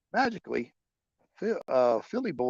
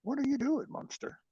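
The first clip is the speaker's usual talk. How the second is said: much higher, sing-song pet voice; 13 semitones higher, 3 dB quieter than usual. Real, not acted. Vlogger scolds his dog playfully in a high pet voice.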